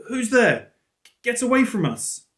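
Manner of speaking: put-on high, loud, scared child's shout into the dark; frightened cry. Hidden child warning off a stranger, high frightened shout. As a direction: yell out, startled and afraid.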